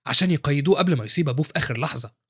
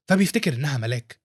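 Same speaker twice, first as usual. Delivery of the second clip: ordinary delivery, nothing marked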